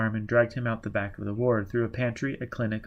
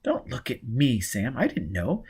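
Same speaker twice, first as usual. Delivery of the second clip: ordinary delivery, nothing marked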